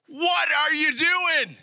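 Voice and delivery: high, drawn-out cry of alarm; panicked disciple. Acted. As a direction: cry out in hammed-up panic, stretching each word. Acting out panicked disciple in storm.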